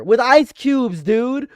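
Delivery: usual speaking voice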